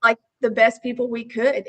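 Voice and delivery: normal talking voice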